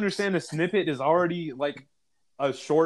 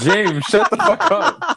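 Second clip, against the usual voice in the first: laughing; 6 semitones higher, 6 dB louder, much slower than usual. Real, not acted. Friends cracking up at story.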